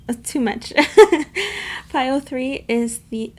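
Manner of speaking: ordinary talk, nothing marked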